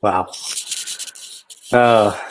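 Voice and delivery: normal talking voice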